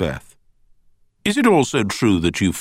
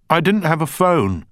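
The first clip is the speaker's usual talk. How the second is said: higher, louder, bursting out; blurted; 13 semitones higher, 6 dB louder than usual. A narrator's reading. Witness Beth blurts back at the barrister, higher and louder. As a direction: blurt it before you can stop yourself. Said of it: "she blurted".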